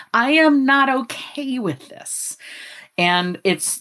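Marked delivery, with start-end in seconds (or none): 0.1-2.4 high, drawn-out inner protest; body protesting. Acted. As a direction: say it slowly and high, each word held out. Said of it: "It's that body saying".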